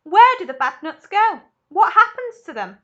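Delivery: loud, high frantic questions; a mother flapping her arms in panic; shrieking. Acted. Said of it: "shrieked Missus Salt".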